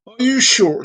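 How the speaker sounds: high, loud mock-tearful plea. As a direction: plead as the distressed pet owner. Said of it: "she says with tears".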